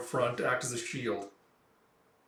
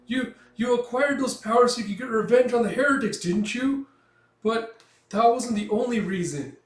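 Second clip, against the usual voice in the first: high, loud accusing tirade; small hero telling off a villain; impassioned confrontation; 9 semitones higher, 7 dB louder than usual. Retold or imitated. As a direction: hurl the accusation in a raised voice. Small character's acted tirade at Count.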